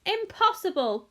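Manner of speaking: high exclamation of denial; cried out. A narrator's reading. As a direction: dismiss it with a cry. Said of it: "cried Mister Wonka".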